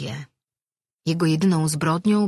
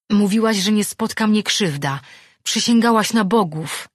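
loud, high-pitched protest; crying out; 5 semitones higher, 6 dB louder than usual. A narrator's reading. Attacked man reminds her of her oath, crying out. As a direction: accuse her with raised voice. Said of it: "zawołał".

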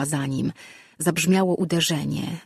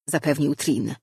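usual speaking voice

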